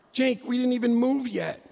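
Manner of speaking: high, loud disbelieving cry at Jake; exasperated protest. Retold or imitated. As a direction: belt out the outrage. Complaining to Jake, left behind.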